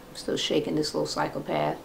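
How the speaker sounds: soft aside while wrestling the pet; quiet aside. Real, not acted. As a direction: grumble under the breath at the pet.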